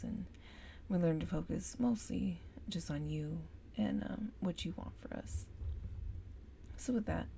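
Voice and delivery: soft, earnest prayer voice. Real, not acted. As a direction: pray quietly, from the heart. Leads a spoken prayer.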